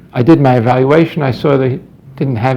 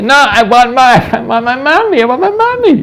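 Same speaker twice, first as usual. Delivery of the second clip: imitated wailing child; 10 semitones higher, 4 dB louder than usual. Six-year-old refusing his father's bedtime, imitated high wail. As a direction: mimic a little boy wailing in protest.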